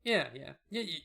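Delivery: ordinary talk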